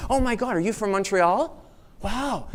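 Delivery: high, gushing "oh my God"; excited recognition. Retold or imitated. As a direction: squeal at meeting a fellow Montrealer. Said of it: "what do you say?".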